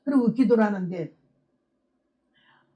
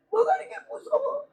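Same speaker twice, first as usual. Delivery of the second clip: high, fearful wail; frightened cry. Retold or imitated. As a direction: recall the terror in her voice. Testimony, reliving fear of death.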